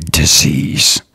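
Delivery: usual speaking voice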